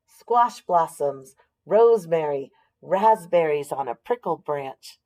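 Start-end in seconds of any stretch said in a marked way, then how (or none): none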